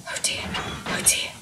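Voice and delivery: whispered, puffing worry; puffed. Acted. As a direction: breathe it out anxiously. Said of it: "puffed Henry".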